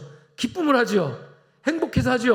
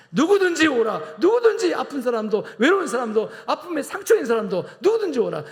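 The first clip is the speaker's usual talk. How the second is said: calling out; 7 semitones higher, 4 dB louder than usual. Acted. Preacher calls all to come.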